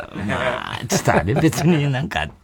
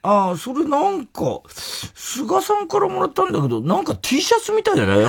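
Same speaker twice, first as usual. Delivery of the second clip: mock boss voice. Imitating the lady boss as she reads the T-shirt, high and mocking.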